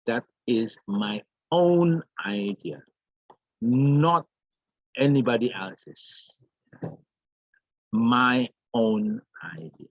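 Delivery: deliberate weight on "own", with pauses round "not"; emphasis on "own". Shown as a demonstration. Teacher demonstrates stressing possession to the class, emphatic on "own". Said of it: "going to give the emphasis".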